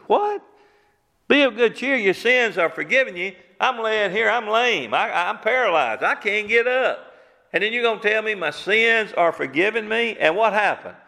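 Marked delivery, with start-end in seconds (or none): 0.1-0.4 a sharp, much higher squeak of disbelief; incredulous what. Retold or imitated. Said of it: "man hears sins forgiven". 1.3-3.3 higher, sarcastic echo of the blessing; mocking repeat. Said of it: "paralyzed man mocks the words". 3.6-10.2 a higher, lightly voiced complaining man; mild indignant protest. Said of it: "paralyzed man protests".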